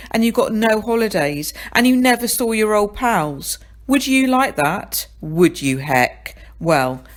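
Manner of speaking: ordinary delivery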